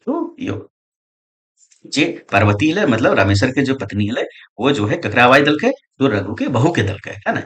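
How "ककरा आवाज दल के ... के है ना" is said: ordinary talk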